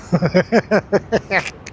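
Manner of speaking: amused giggle; laugh. Real, not acted. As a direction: laugh at your own joke. Laughs at own bad advice.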